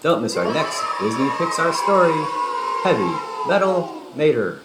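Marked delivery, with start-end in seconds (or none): none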